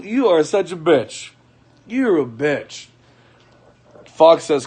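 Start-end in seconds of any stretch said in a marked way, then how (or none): 0.0-2.9 drawn-out scornful insult; slow and deliberate. Real, not acted. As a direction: space out every word. Slowly insulting the boyfriend.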